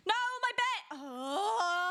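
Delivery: high panicked wail over lost bed; distressed cry. Real, not acted. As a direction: cry out in dismay. Bed burned in lava.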